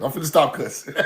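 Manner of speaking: low, soft mock Casey Macon voice; a deep, hushed take on a cleaned-up preacher; imitating Casey Macon. Mocking a critic, low and hushed, promising to stop cussing. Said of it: "I'm finna be like Casey Macon".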